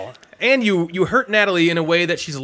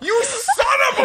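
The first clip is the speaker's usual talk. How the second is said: high-pitched, laughing yell of indignation; mock outraged shout. Co-host reacting to a roast of him, mock outraged shout. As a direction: cry out in playful outrage through laughter.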